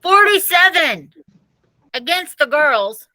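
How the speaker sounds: loud, high bellow through cupped hands; boy bellowing the score over cafeteria noise. Acted. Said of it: "and bellowed".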